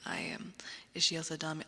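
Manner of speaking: normal talking voice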